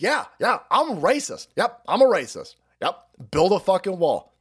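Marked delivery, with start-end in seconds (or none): none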